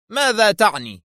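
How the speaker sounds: high, puzzled question; bewildered. A narrator's reading. Director baffled by Kwaleski's doubt, bewildered. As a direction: ask back in confusion. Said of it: "حدق المدير فيه بحيرة قائلا".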